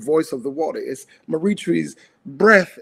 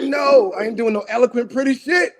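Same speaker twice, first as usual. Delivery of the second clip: raised, high-pitched grieving outburst; indignant retold rant, pitched way up; angry protest; 9 semitones higher than usual. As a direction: climb higher into disbelieving outrage. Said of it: "I'm angry 'cause I'm like".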